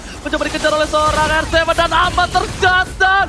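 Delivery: hyped live play-by-play call; fighting game announcer. Acted. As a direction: call the teamfight like a hype caster. (komentator esports yang bersemangat)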